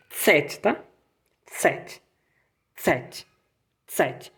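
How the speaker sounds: tsete, ts onset, quiet; German Zet. Shown as a demonstration. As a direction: repeat tsete softly. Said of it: "O Z vai se pronunciar tsete".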